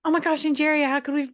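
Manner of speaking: high, loud and fast gushing; mock gushing. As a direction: gush over Jerry like a fan. Mock gushing about Jerry.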